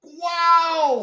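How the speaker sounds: loud, high-pitched exclamation of amazement; astonished wow. Real, not acted. Stunned by co-host's ranking.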